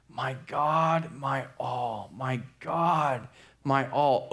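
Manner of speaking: understated, drawn-out fervent prayer; restrained cry to God. Acted. Francis alone on the ground at night, crying out in prayer. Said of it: "he begins crying out".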